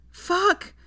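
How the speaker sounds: high, loud, panicked curse; desperate yell; crying out. A narrator's reading. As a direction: burst out in panic. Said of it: "she cried out".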